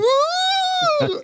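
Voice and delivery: very high whooping cry; high held party whoop. Retold or imitated. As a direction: whoop like rowdy kids crashing in. Kids raid with pots.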